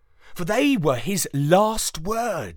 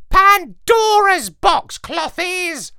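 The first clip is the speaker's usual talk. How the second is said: high, loud, know-it-all parrot voice; lecturing parrot; 9 dB louder, slower than usual. A narrator's reading. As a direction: lecture like the brainiest bird. Parrot corrects the dog.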